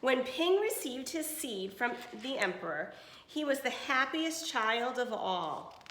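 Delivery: clear, even storybook reading; reading to children. Acted. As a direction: read it to a class of kids. Reads picture book to class.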